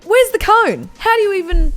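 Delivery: high, loud cry of disbelief; outraged demand. Acted. Opening the packet to find no cone, outraged. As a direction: burst out in mock outrage.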